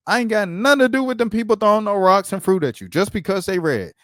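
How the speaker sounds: high, indignant mock voice; mock indignant activist. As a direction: play an indignant activist, huffy. Voicing an activist mocking the foundation.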